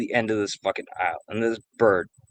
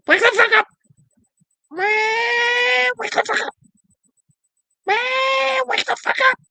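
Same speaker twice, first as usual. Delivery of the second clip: piercingly high parrot squawk, building higher and louder; screaming cockatiel; 6 dB louder than usual. Retold or imitated. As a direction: screech like a bird demanding attention. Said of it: "he'd sit there and scream at you".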